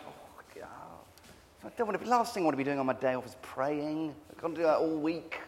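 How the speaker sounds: fast, half-whispered grumbling reply; reluctant groan. Retold or imitated. Telling his vicar friend he doesn't want to pray on his day off, grumbling. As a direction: mutter the excuse under your breath, quickly.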